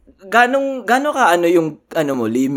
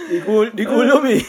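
mock innocent; 11 semitones higher, 5 dB louder than usual. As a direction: play dumb with a lilting shrug. Playing dumb about getting caught.

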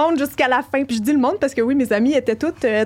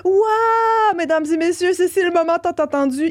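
mock announcer; 5 semitones higher than usual. Reading the long-awaited kiss to friends, mock announcer. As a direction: announce the big moment with mock grandeur. Reads fanfiction's big moment as announcement.

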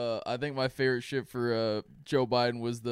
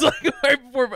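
laughing; 12 semitones higher, 8 dB louder than usual. Real, not acted. Laughing while telling.

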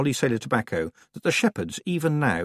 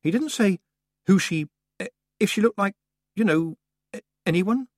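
trying to sound nonchalant; 11 semitones higher, 4 dB louder than usual. A narrator's reading. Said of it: "trying to sound nonchalant".

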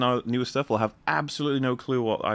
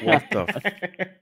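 shocked; 10 semitones higher, 3 dB louder, much slower than usual. Retold or imitated. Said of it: "Be like".

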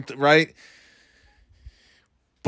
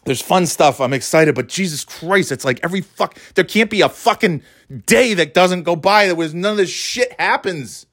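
usual speaking voice

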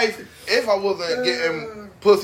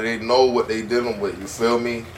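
ordinary talk, nothing marked

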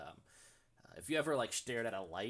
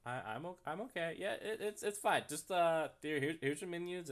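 flustered high voice; 7 semitones higher than usual. Acted. Waiter flustered after the rejection.

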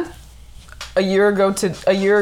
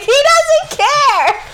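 high, drawn-out wail of protest; hammed-up, stretched-out complaint; mock protest; 4 dB louder, much slower than usual. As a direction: re-enact protesting to mom, half laughing. Acts out protesting to mom.

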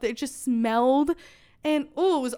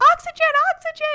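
high, loud mock scream; imitated panicked scream; 5 dB louder, much faster than usual. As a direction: scream for urgent help, imitating a panicked nurse. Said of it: "She's screaming".

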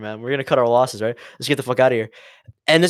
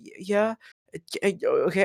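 soft, hesitant mock-timid reply; meek stammer; 3 semitones higher, 7 dB quieter, faster than usual. Student answering the embassy man, acted meek stammer. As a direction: stammer it quietly, acting cowed.

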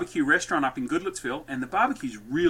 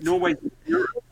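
pitched way up, puzzled lady; high mock confusion. Retelling the confused customer, a high mock puzzled voice. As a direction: play her baffled and high. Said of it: "she goes".

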